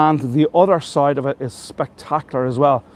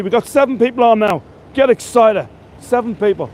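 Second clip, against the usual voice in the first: higher-pitched, excited rallying call; real excitement; 7 semitones higher, 3 dB louder than usual. Real, not acted. Tour guide rallies his live viewers, excited. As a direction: get the crowd excited. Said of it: "I'm always excited".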